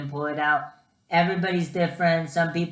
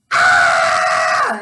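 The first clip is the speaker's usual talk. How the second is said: very high, very loud drawn-out scream; long scream; 11 dB louder than usual. Retold or imitated. Patient as the stent comes out, screaming long and high. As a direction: scream on and on at the top of the voice. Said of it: "I started screaming for, like, ten seconds".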